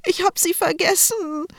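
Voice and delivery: put-on high, tearful small child's voice; a little boy wailing over his lost cap; little brother crying in the changing room, high and tearful; sobbing little boy. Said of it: "schluchzte der kleine Paul".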